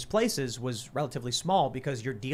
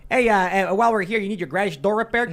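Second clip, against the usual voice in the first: loud, high put-on Chicago accent; 5 semitones higher, 7 dB louder than usual. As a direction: pitch business like a loud Chicago local. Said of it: "please do your Chicago accents".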